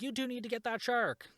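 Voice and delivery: high-pitched mock order; imitated urgent demand. Lisa runs off telling them, high imitated demand. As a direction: imitate a character's parting demand, pitched well up. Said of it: "Lisa runs off and says".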